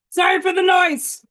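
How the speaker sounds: loud apology. Real, not acted. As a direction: call it over the racket. Noise in background.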